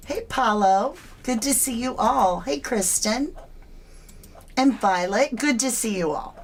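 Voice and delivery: usual speaking voice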